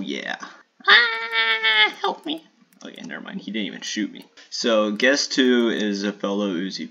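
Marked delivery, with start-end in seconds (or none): 0.9-2.4 very high ah, help me; real mock-panicked squeal; panicked shriek. Real, not acted.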